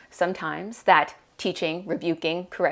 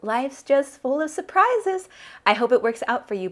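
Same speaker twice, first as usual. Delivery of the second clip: high, falsely pleasant well-wishing; forced composure; 7 semitones higher, 4 dB louder than usual. A narrator's reading. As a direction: mask anger with breezy politeness. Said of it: "I keep my voice steady".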